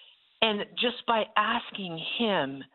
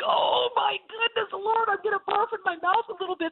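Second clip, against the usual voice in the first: high laughing wail of embarrassment; half-laughing cry of dismay at the poem; laughing mock horror; 13 semitones higher than usual. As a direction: squeal it out, cringing at yourself. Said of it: "It was like".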